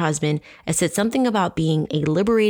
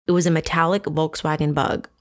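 usual speaking voice

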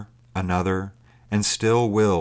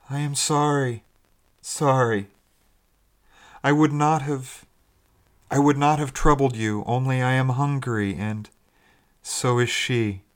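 higher-pitched, pitiful plea from afar; hopeless and pathetic; 5 semitones higher than usual. A narrator's reading. Unseen stranger apologising to sailors for begging food, hopeless and pathetic. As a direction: plead hopelessly, words faltering into pauses. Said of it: "sounding extremely hopeless and pathetic".

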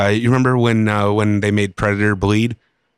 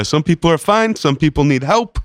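Bernie impression; 7 semitones higher than usual. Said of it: "that's more of a Bernie".

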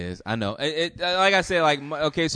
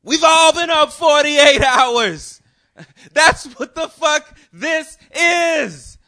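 much higher, louder, slow shout; going off, shouting; 9 dB louder, slower than usual. Retold or imitated. Girlfriend shouts her excuse at him, slow loud shout. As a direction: yell it out slowly, much higher. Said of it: "She's just going off or whatever".

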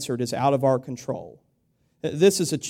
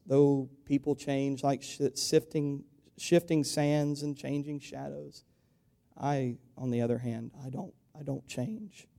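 God speaking softly; 8 dB quieter than usual. Retold or imitated. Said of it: "says God".

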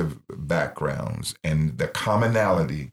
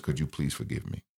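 hushed, pleading request for forgiveness; soft plea; 4 semitones lower, 9 dB quieter than usual. Retold or imitated. Husband softly begging his wife's forgiveness on the phone, quiet plea. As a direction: ask it gently, almost under your breath.

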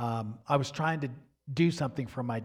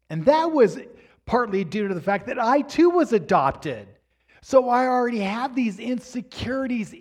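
louder, higher preaching voice pressing the point; impassioned preaching; 5 semitones higher, 7 dB louder than usual. Real, not acted. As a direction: preach it with rising fervor. Preaching at full force.

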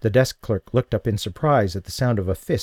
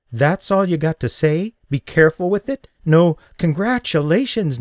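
indignant; 8 semitones higher, 3 dB louder than usual. Acted. Offended woman.

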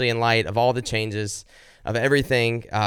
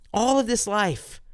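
emphatic plea; 9 semitones higher, slower than usual. Acted. Impassioned prayer peak.

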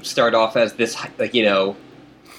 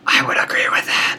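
whispering. Real, not acted. Whispered agreement.

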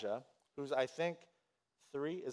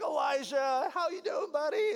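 excited greeting; 10 semitones higher than usual. Retold or imitated. Greeting a toddler he adores.

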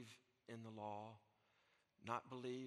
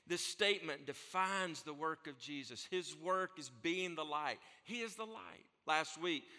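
full-force preaching; 5 semitones higher, 11 dB louder than usual. Real, not acted. Preacher proclaims Jesus' words to the church, at full force. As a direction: drive it home like a revival preacher.

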